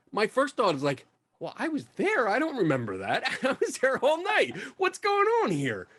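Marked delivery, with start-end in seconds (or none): 1.4-5.9 high-pitched, bewildered protest; baffled disbelief. Retold or imitated. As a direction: play up the confusion, voice climbing very high. Acts out his baffled first thought.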